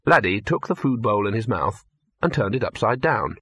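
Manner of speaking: ordinary delivery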